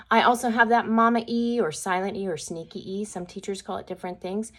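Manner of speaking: usual speaking voice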